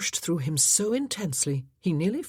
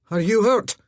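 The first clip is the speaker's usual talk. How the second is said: louder, higher harsh question; 5 semitones higher, 9 dB louder than usual. Acted. Laird asking the lass if she is hurt, harsh. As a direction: bark it from fear. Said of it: "harsher than he'd intended".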